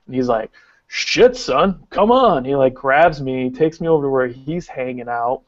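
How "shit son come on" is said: Jake impression. Drunk wrestler greeting the narrator.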